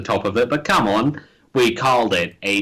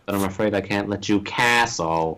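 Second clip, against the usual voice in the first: HAL impression; 5 semitones lower, 4 dB quieter than usual. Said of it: "hal nine thousand".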